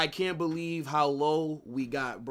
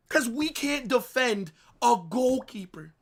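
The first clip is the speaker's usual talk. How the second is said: outraged yell; 9 semitones higher, 8 dB louder than usual. Real, not acted. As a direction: drag out each word and shout it in disbelief. Continued outraged rant.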